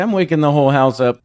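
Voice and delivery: normal talking voice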